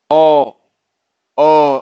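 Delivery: ओ drawn out loud as a long O; long O sound. Shown as a demonstration. Teacher sounding out ओ for the class. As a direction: sing out ओ as a long vowel. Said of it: "इसको हम ओ read करेंगे".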